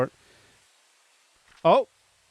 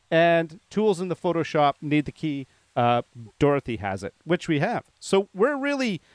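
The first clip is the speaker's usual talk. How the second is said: ordinary delivery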